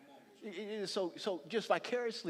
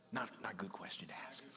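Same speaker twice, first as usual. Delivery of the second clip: hushed warning aside; whispered aside; 10 semitones lower, 6 dB quieter than usual. Real, not acted. Preacher whispering a warning aside to the congregation. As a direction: drop to a whisper, confiding.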